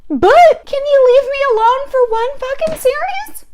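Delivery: high, loud, building rant; exasperated rant. Real, not acted. Venting at Penguins fans about the playoff series, loud rant. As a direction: vent at the rival fans.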